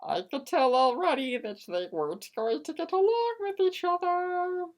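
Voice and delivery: ordinary delivery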